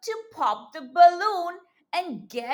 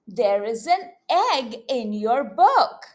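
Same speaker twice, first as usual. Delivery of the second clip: ordinary delivery, nothing marked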